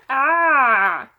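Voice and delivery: put-on loud, high baby coo; baby sound. A narrator's reading. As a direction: coo like an infant. Baby Tootsie vocalizing.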